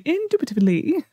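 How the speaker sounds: INDUBITABLY with a British lilt at the end; slight British accent. Shown as a demonstration. Streamer who can't even say it, slight British accent. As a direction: finish INDUBITABLY with a touch of British. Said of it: "You have to put, like, a slight British accent".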